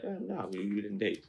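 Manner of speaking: soft, offhand shrug of a denial; casual dismissal. Retold or imitated. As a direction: brush it off quietly, no big deal. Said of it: "I was just like".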